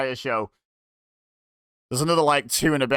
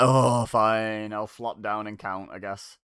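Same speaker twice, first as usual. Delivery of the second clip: imitated grudging referee, lower and fading away; can't be bothered; 3 semitones lower, slower than usual. Referee grudgingly agreeing to count the pin, can't be bothered. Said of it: "could not be bothered".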